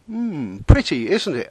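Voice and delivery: lightly dreamy, drifting voice; dreamy musing. Acted. Narrator dreamily admiring a bird, mildly dreamy. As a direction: muse it off in a daydream. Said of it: "I reflected dreamily".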